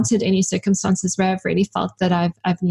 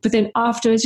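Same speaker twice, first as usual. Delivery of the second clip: ordinary talk, nothing marked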